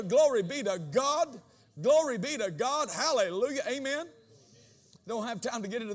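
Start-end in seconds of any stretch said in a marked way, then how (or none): 0.0-1.4 slow praise. Acted. Repeated praise. 1.8-4.1 loud, higher hallelujah preaching; shouting praise from the pulpit; full-force praise. Acted.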